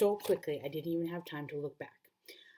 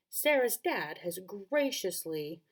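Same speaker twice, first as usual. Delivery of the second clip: put-on British accent; 3 semitones higher, 4 dB louder, much slower than usual. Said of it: "I forgot to do the British accent".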